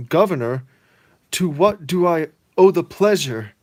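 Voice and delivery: high, loud, overly polite; mock gracious greeting; Pete greeting governor. Pete greeting the governor, hammed-up polite. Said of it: "And he says".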